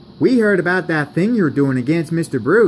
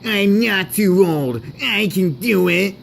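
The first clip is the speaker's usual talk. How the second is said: mildly raised, put-on protesting voice; indignant protest; 7 semitones higher, slower than usual. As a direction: insist you can still do it. Edward protests being called old.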